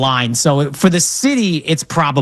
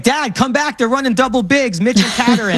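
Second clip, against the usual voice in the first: put-on higher-pitched take on an eager kid; imitating excited son; 8 semitones higher than usual. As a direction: call Dad back like a basketball-obsessed little boy. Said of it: "this is the kind of kid who".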